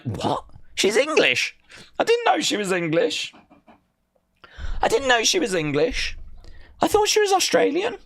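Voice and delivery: high-pitched, incredulous mock astonishment; retold surprise. Streamer reenacting finding out Mariah is English, high-pitched surprise. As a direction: act out shocked disbelief, voice shooting up. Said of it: "I was like".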